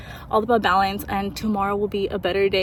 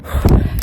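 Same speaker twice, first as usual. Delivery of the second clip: sharp winded breath out; loud breathless exhale; 8 dB louder than usual. Real, not acted. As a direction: blow out hard after running. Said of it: "I'm just out of breath".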